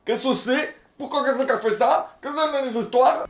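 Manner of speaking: mock bewilderment. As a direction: ask in rising, squeaky-pitched confusion. Escalating mock confused questions.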